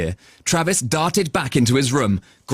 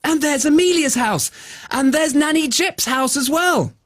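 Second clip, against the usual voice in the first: put-on excited boy; 13 semitones higher than usual. Travis pointing out houses.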